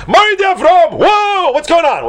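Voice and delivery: very high, loud astonished cry; hammed-up scholarly shock, high and loud; excited outburst. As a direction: explode in mock alarm. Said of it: "getting all excited about".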